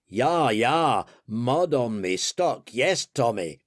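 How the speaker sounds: put-on joke-teller's rough German accent; attempted German accent. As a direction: answer in a makeshift German accent. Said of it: "And the German replies".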